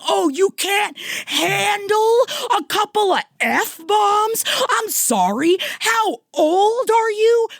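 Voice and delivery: very high, drawn-out mocking wail; slow, hammed-up indignant yell at high pitch. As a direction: taunt in a squealing, outraged voice. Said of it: "this is me yelling this".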